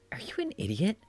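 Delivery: breathy bratty whisper; scornful whisper. Acted. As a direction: hiss it under your breath, scoffing. Bratty character scoffs.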